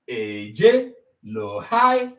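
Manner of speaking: Eje low, then hai said high. Shown as a demonstration. Teacher spells the tones, low then high. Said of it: "look at their tone marks".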